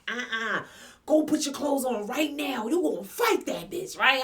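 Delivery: a hint of a bossy sister; sister ordering. Sister hurrying her to get dressed, mildly bossy. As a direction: push her out the door to fight. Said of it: "My sister like".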